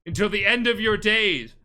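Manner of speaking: loud, high, dramatic proclamation; retold shouted threat. Uncle to Jin as he walks away, threatening shout. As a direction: call it out after someone walking away.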